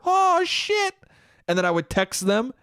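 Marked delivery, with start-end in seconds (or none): 0.1-0.9 high thrilled yelp; excited. Retold or imitated. Younger self thrilled at a phone number, high yelp. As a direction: squeal at getting a number. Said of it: "I would get a rush".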